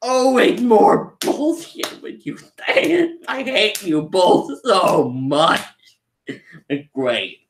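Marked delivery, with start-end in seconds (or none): 0.0-5.6 higher, slow exasperated whine; a beaten Frieza groaning in frustration; imitating Frieza. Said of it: "he's just like".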